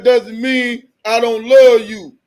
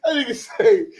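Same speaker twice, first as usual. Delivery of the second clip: laughing; 3 semitones higher, 3 dB quieter than usual. Real, not acted. Still laughing.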